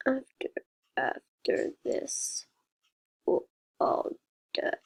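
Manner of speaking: low, slow rasping whisper; creepy whisper. A narrator's reading. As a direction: hiss it like a lurking monster. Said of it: "like a disturbing robot".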